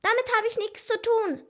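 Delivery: high, defensive blurt; nervous suspect's high-pitched protest; shrill outburst. A narrator's reading. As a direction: burst out in shrill denial. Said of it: "platzte aus ihr schrill heraus".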